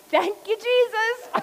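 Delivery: high, loud, slow relieved "Thank you, Jesus"; loud grateful gasp of relief, pitched high. Retold or imitated. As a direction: blurt it out like you just dodged a crash. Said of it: "And I just am".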